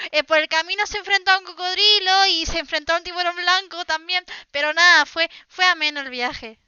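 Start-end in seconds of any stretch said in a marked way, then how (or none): none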